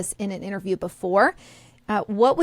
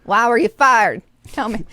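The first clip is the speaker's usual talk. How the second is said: Southern accent; 2 semitones higher, 5 dB louder than usual. Shown as a demonstration. Co-host jokingly repeats the firing question to Ron, Southern accent. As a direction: put on a punchy Southern accent. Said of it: "notice I said it with a Southern accent".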